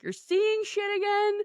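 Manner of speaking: high-pitched disbelieving question; mock incredulous parents. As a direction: imitate a parent's alarmed double-take.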